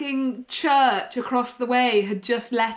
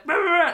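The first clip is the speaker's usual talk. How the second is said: high-pitched mock dog barks; quick rolled "rah" barks; imitated dog barking; 6 semitones higher, 4 dB louder, faster than usual. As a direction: bark like an excited guard dog, in rapid bursts. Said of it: "like, barking".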